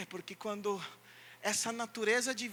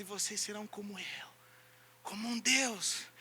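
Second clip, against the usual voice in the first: whisper; 2 semitones lower, 4 dB quieter than usual. Acted. Preacher quoting God's promise, whispered. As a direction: breathe it out in awe.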